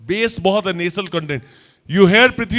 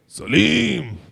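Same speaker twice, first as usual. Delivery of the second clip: attempted emperor shout; much slower than usual. Volunteer tries Akbar line.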